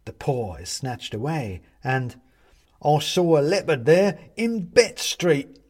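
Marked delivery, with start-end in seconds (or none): 2.8-5.6 loud, high, slow china lady; old woman calling out, high and loud. Acted. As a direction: shout it across the room. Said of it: "says another voice".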